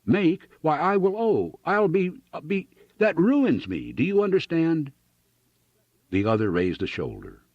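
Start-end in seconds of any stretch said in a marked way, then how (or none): 0.0-4.9 high-pitched, frantic protest; shouting. A narrator's reading. Ruined farmer making clerk understand, shouting. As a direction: plead and rage, stumbling over the words.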